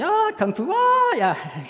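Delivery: very high, loud martial-arts yelps; mock kung fu cry. Intruder showing off kung fu to police, high mock cries. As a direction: yelp like a kung fu fighter. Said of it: "he said".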